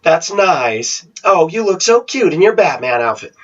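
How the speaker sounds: high, cooing talk-down voice; mock patronizing adult to child; condescending baby talk. Shown as a demonstration. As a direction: gush in a high fake-sweet voice. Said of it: "When you tower over them and go".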